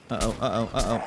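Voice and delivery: usual speaking voice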